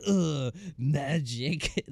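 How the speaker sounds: mock smug show-off. Mocking showy noble kids.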